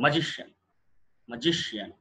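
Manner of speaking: Magician with stressed GI and one light final syllable; correct pronunciation. Shown as a demonstration. Teacher corrects the ending of magician, middle syllable stressed. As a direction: punch GI in magician, let the ending fall. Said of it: "షియన్ అనకూడదు".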